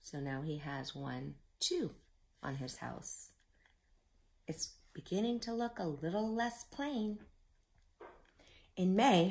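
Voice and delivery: ordinary delivery, nothing marked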